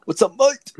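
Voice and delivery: mate jumps up high, a hiccup bursting out on "mate"; hiccupped greeting. Retold or imitated. Mocking a hiccup that turned man into mate. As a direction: squeak the word "mate" as if hiccupping. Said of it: "they had the hiccup".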